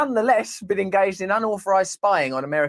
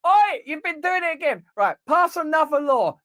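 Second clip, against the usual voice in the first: scolding; 11 semitones higher than usual. Acted. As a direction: tell them off. Lawmaker scolding in skit.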